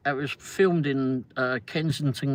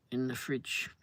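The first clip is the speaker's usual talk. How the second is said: ordinary delivery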